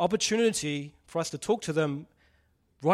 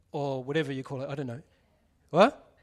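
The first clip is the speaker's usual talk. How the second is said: usual speaking voice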